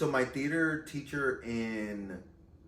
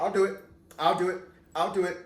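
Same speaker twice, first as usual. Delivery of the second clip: higher, louder repeated offer; eager volunteering; 5 semitones higher, 5 dB louder, much faster than usual. Retold or imitated. Student volunteering to drama teacher, eager. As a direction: jump in before anyone else can.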